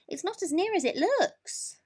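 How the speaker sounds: high squirrel voice. A narrator's reading. Squirrel warns Mole about the moon, high and chirpy.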